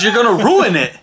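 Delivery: high, loud imitated child outburst; scolding kid. Daughter scolding dad for salting the eggs, loud mock alarm.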